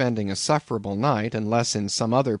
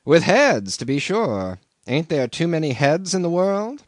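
higher, louder teasing innkeeper; landlord's joke; 7 semitones higher, 4 dB louder than usual. A narrator's reading. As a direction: savour the joke about heads. Landlord jokes.

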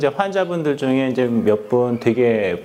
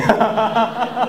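burst of laughter; 9 semitones higher than usual. Real, not acted. Joke about treating his wife.

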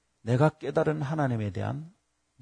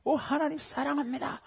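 high, softened cry of praise; mock-shouted praise, held back in level; joyful cry; 9 semitones higher, 9 dB quieter than usual. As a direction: imitate rejoicing without full volume. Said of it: "즐거이 소리치는 거예요".

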